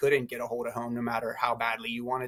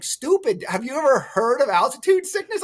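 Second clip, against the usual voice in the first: high, mocking put-down voice, a bit louder; mocking locals; 10 semitones higher, 6 dB louder than usual. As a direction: imitate the locals scoffing at the clueless newcomer. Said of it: "And they were like".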